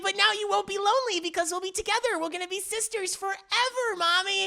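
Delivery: put-on high-pitched, rushed excited girl; gushing eagerness. Acting Monica promising Mommy sisterhood, gushing. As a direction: gush it in a high, quick voice.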